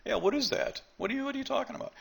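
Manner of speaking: mock incredulous crowd. Speaker mimics people dismissing a claim, in mock disbelief. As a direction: ham up a doubter who won't buy it. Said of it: "people go".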